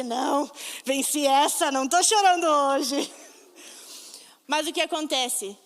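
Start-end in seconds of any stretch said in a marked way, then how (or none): 0.0-3.1 slow, a touch louder, proud; slow proud boast about not crying; proud little victory. Retold or imitated. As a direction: savor the small win. Proud reply to pastor's text.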